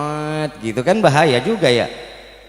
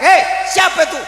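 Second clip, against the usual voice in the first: high, loud yell; Bedouin yelling; 13 semitones higher, 5 dB louder than usual. Acted. Bedouin hunter yelling at whoever freed his deer, loud yell. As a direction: shout out at a stranger.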